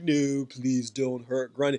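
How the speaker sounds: slow, lightly voiced whimpering monster plea; imitating sniveling Grundy. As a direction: beg softly like a crying giant, slowed down. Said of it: "crying, and he was like".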